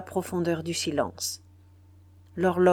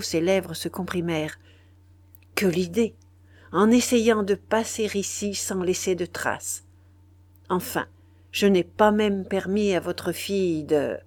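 ordinary delivery, nothing marked

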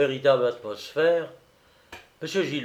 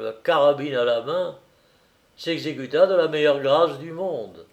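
usual speaking voice

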